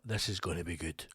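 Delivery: low, soft gleeful aside; quiet private glee; inner thought. Retold or imitated. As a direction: murmur it to yourself. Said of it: "because I thought".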